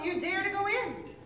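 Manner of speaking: usual speaking voice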